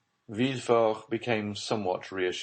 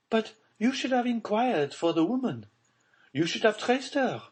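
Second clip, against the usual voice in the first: Baroness voice. Acted. Baroness demanding of Villefort about her buried child, high and frightened. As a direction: pitch her up high, a mother in dread.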